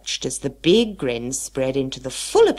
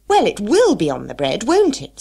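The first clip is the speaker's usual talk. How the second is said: louder, higher cross retort; crossly; 6 semitones higher, 7 dB louder than usual. Acted. March Hare answering the Hatter crossly, louder and higher. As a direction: snap back crossly. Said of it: "said the March Hare rather crossly".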